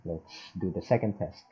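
ordinary talk

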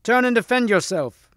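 high-pitched call to fight; shouted challenge. Acted. Percival dares the thief to fight, shouting. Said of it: "shouted Percival".

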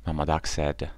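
plain reading voice

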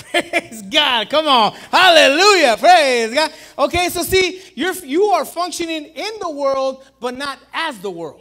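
loud, high shouted praise; preaching at full force. Real, not acted. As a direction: let it rip like a revival preacher.

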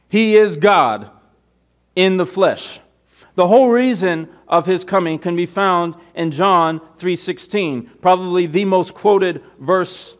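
loud, high-pitched sermon delivery; forceful preaching. Real, not acted. Preacher reading John three to the congregation, full-force preaching. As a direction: proclaim it to the congregation, drawing out key words.

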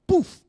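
sudden high, loud poof; a loud comic poof for something appearing; magic poof. Pretend. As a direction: burst it out like a magic trick. Mock magic appearance sound.

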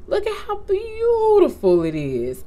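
high-pitched cooing over something lovely; gushing admiration. Real, not acted. Vlogger shows off her handmade gift, gushing high. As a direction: swoop up high on the admiring words.

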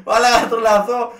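high, fast exasperated laughing; γελώντας απογοητευμένα; laughing exasperation. Real, not acted. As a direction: laugh in disbelief at the mix-up.

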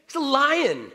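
loud, high proclamation; emphatic. Shown as a demonstration. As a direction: proclaim it with full force.

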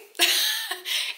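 high-pitched burst of laughter. Real, not acted. Vlogger amused at her own lazy day off, laughing.

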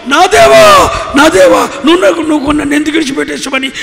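a howl of anguish, the first దేవా stretched long and high; anguished cry. Acted. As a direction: cry out to God as if abandoned. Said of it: "అప్పుడు నువ్వు అరుస్తావు".

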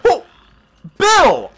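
shout. Real, not acted. Yelling at companion in game.

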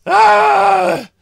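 high, loud, near-crying cheer; can't contain the awe; real thrilled yell; ecstatic shout. Real, not acted. Awed by the guitar shredding.

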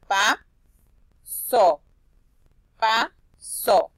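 pa, so as two loud syllables with a gap; split into syllables. Shown as a demonstration. Teacher reading pasó in parts, pa, so split apart. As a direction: separate pa from so, each firmly voiced. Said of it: "Junto la palabra dice pasó".

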